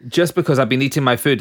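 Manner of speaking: ordinary talk, nothing marked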